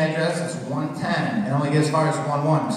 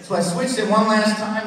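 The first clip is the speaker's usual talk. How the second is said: ordinary talk, nothing marked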